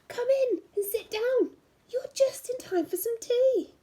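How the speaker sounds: high, friendly wolf voice; a hint of a welcoming wolf; lightly voiced wolf. The last wolf inviting Red in for tea, high and friendly. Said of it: "said the last wolf".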